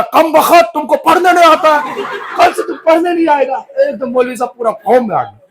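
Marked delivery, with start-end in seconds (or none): 0.0-3.7 furious shout, much higher and louder; angry scolding. Acted. The stern cleric scolds the new pupil for misreading, furious shout. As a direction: blast the pupil like a raging cleric. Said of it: "इतना ना इसको डांटा".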